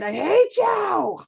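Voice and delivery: put-on high, very slow, drawn-out kid voice; child's angry line. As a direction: mimic a child sulking "I hate you". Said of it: "Everyone's gotten this one".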